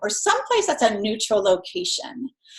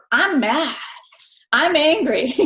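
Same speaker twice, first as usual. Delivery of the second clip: mildly angry; 4 semitones higher, slower than usual. Retold or imitated. Mother recalling her fury at her son, mildly angry. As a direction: let mild exasperated anger into the retelling. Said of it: "at that point in time".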